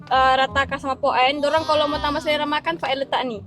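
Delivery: ordinary delivery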